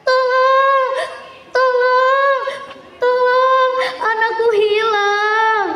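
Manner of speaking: high, loud, frantic calls for help; a mother screaming for her lost child; panicked pleas, slowing at the end; desperate cry for help. A narrator's reading. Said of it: "Mendengar teriakan itu".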